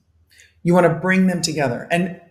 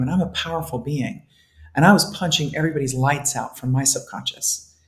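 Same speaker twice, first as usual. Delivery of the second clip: ordinary talk, nothing marked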